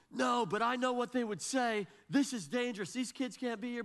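high-pitched, indignant rant; imitating angry yelling. Stranger yelling at the dad on the mountain, mock-angry. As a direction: argue back in a high, heated voice. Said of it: "he starts yelling at me".

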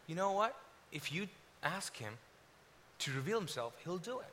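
very soft, measured reply; quiet assurance. Retold or imitated. Friend answering a mocking skeptic, quiet assurance. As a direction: answer the mockery softly, with pauses.

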